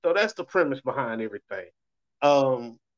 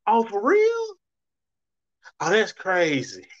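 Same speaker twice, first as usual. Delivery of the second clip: fake interest; 5 semitones higher than usual. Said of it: "it trips me out".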